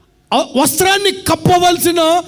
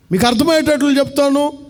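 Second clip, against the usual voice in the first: normal talking voice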